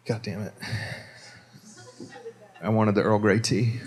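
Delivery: low-key, muted self-reproach; soft, deflated realization; quiet regret. Retold or imitated. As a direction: curse softly to yourself. Retold private regret over order.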